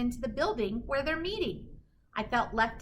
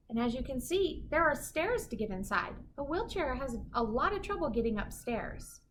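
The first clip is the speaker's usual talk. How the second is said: ordinary delivery, nothing marked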